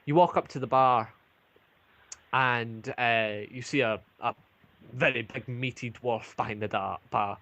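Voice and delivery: ordinary talk, nothing marked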